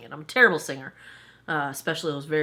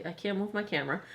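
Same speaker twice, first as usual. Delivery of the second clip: ordinary delivery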